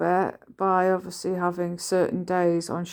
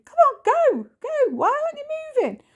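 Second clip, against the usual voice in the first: very high, fast frustrated squeak; mock shrieking at traffic; impatient high squeal; faster than usual. Stuck in the car, mocking impatience in a squeaky high voice.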